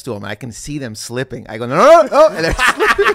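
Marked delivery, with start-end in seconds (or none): 1.7-3.1 high, loud panicked pleading; mock panic. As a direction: cry out as disaster starts, played for laughs. Acts panic as cousins slip.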